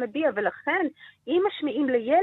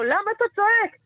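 high, louder mock yell; mock shout; 8 semitones higher, 4 dB louder than usual. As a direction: throw it out like a nagging shout. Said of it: "ושאומרים לו". (צעקה מבוימת, גבוהה וחזקה)

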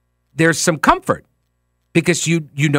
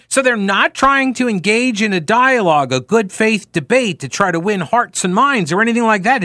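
usual speaking voice

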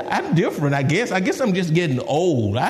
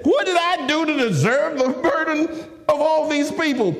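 high, exasperated protest to God; indignant preacher-acted complaint; aggravated Moses; 13 semitones higher than usual. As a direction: demand an answer, pitched way up. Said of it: "Moses was also very aggravated".

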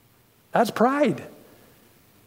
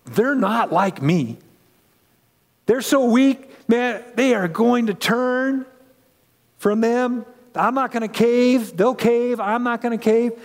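higher, cocky bragging voice; boastful Peter; 6 semitones higher than usual. Retold or imitated. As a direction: brag like a proud disciple who looks down on the others. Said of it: "Peter's thinking".

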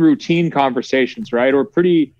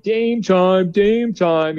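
higher-pitched singsong jeer; mock taunt; 6 semitones higher, much slower than usual. Reporter imitating a player taunting Lillard from the bench, mock taunt. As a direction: lightly imitate a teasing chant. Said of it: "just basically like joking".

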